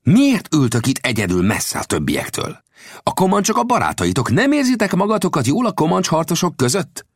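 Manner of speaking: high, sharp interrogation; high-pitched, pointed challenge; sharp questioning. A narrator's reading. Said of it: "Végül éles hangon megkérdezte".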